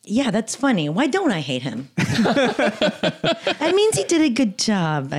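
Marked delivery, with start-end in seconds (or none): 1.9-5.2 high, drawn-out words through laughter; laughing. Real, not acted. Amused at her own question about not hating him, laughing as she speaks. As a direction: laugh your way through the line.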